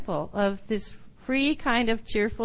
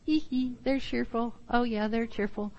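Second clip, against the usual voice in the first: mock giggly cheerful person; 6 dB quieter than usual. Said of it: "you kinda get the, like".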